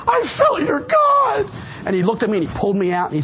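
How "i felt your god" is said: high, slow, loud sob of gratitude; tearful shout. Retold or imitated. Crying newcomer telling his sponsor-to-be he felt God. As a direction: wail it out, overcome. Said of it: "and I'm crying".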